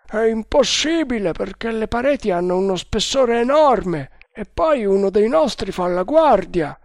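the second guard brushes it off; doubting guard. A narrator's reading. Guard reassures. (guardia incredula, voce alta e forte)

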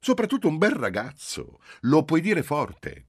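put-on high, loud, lingering young woman's voice; eager agreement. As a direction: girlfriend gushing over her fiancé's looks. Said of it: "confermò Giulia". (Giulia conferma entusiasta, voce alta e calcata)

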